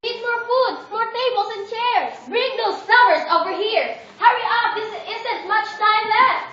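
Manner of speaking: high, loud bustling commands; hurried orders. Acted. Father urging servants to hurry, high and loud. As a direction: rush the servants along.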